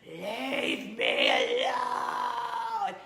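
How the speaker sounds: slow, loud anguished scream; retold scream at her daughter, full force. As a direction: scream it out, drawn long and raw. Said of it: "I straight up screamed at my daughter".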